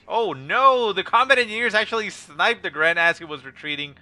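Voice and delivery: loud, high dismay; excited shout. Real, not acted. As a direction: burst out at the surprise kill. Caster reacts to sudden kill.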